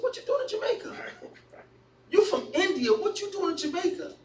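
high-pitched comic disbelief; incredulous questioning. Retold or imitated. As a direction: question the shopkeeper as if it makes no sense. Retells his challenge to shopkeeper.

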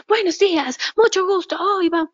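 high, bright-pitched mock cheer; overly eager greeting. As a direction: ham up a pushy hyper greeting. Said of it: "no tiene que estar". (saludo eufórico exagerado, agudo)

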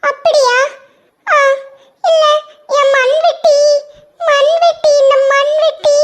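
put-on high child's voice, flustered; a boy tripping over his words; stammering little boy. Ringu stammers about his shovel, high child voice.

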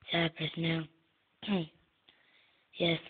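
ordinary talk

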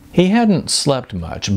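ordinary delivery, nothing marked

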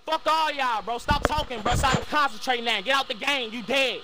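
loud, angry tirade; real shouting at the whole lobby; furious yelling. Real, not acted. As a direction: blast them with rage. Heated voice-chat argument.

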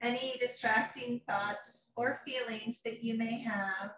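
a lightly soothing yoga teacher guiding a meditation; guided meditation. Acted. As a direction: ease the class into calm. Leads a guided relaxation.

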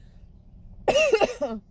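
sudden hard choking cough; coughing fit. Real, not acted. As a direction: cough out a swallowed bug. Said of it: "think I just swallowed a bug".

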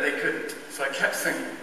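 ordinary delivery

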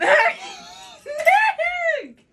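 high laughing shout of a name; laughing call. Real, not acted. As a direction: squeal his name through laughter. Cracking up, calling to friend.

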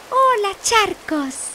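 high, slow mouse hello; ratón muy bajo. Acted. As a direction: draw out a high storybook greeting. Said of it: "Ratón muy bajo dijo". (saludo agudo y alargado de ratón)

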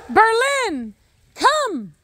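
high, carrying summons; calling out. Real, not acted. Owner calling the dog to come, high shout. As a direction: call the dog in from far off.

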